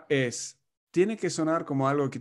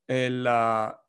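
usual speaking voice

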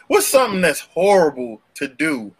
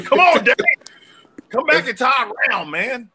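high, raised-voice plea to come back; mock summons, pitched way up; calling out; 9 semitones higher than usual. Man calls the absent Dave back to the show, calling out.

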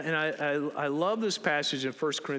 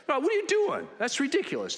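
higher, mock indignant scolding; 11 semitones higher, faster than usual. Disciples scolding Mary over the perfume, mock indignant. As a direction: chide her as the huffy disciples would. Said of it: "They're scolding her".

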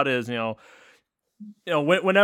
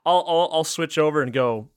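ordinary delivery

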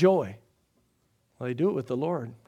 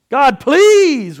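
pleading prayer; 8 semitones higher, 11 dB louder than usual. Retold or imitated. Voicing a begging prayer.

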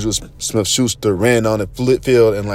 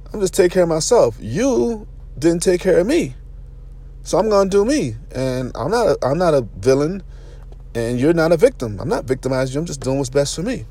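higher, defiant self-justification; self-justifying inner voice; 6 semitones higher than usual. Retold or imitated. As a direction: talk yourself into walking away. Voicing his self-justifying inner thoughts.

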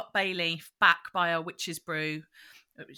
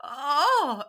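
mock alarm; 6 semitones higher, 8 dB louder than usual. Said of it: "she's like".

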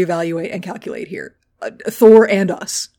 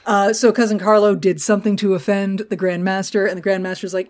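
normal talking voice

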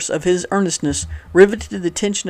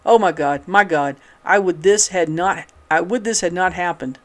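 usual speaking voice

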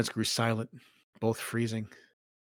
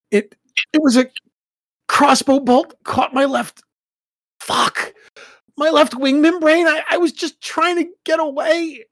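much higher, strained with pain; telling it through the hurt, higher and louder; pained distressed son; 8 dB louder than usual. Acted. Son telling painful story.

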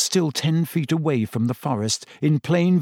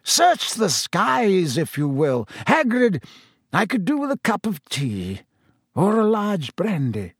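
higher, lilting amused ease; 6 semitones higher than usual. A narrator's reading. Headmaster dismisses the search and asks for tea, amused. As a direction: toss it off with leisurely amusement. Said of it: "still sounding amused".

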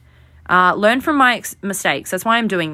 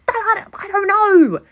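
much higher, whiny self-mocking plea; flustered squeaky protest; 10 semitones higher, slower than usual. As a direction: squeak it out like a guilty kid defending herself. Flustered reply to angry dad.

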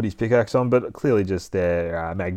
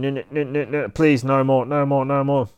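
higher-pitched, hammed-up begging; mock screaming plea; 5 semitones higher, 4 dB louder than usual. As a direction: plead in rapid repeats, only half screaming. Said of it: "he starts screaming".

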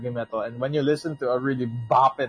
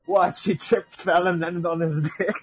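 mock-innocent excuse, higher pitched; 5 semitones higher than usual. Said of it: "And [name]'s like".

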